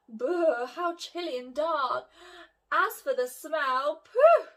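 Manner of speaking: loud, high disgusted pooh. Acted. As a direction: wrinkle your nose at the smell. Disgust at the sewer.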